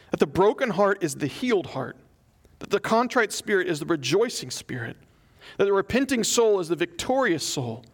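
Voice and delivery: prayer read aloud. A narrator's reading. Pastor reads a Puritan prayer to congregation, fervent reading. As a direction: read it as fervent prayer, lifting each paradox.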